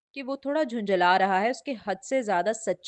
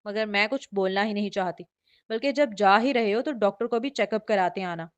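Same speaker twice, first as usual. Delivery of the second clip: ordinary delivery, nothing marked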